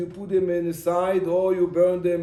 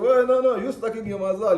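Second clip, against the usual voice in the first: higher and louder burst of no, no; loud retort; 7 semitones higher, 6 dB louder than usual. Retold or imitated. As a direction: cut her off with a sharp loud correction. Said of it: "They said".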